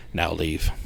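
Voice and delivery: low, soft retold command. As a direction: drop down low and quiet to order them out. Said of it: "and the voice goes".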